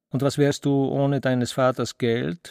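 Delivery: usual speaking voice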